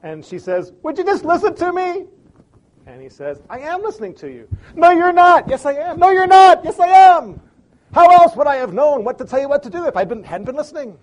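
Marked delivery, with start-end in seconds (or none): 0.8-2.1 high, loud, exasperated demand; exasperated plea. Acted. Wife snapping at husband who won't listen, high and exasperated. 3.5-4.5 defensive. Acted. Husband defends himself in quarrel. 4.8-11.0 high, loud back-and-forth yelling; shouting match. Acted. Husband and wife yelling at each other at the sink.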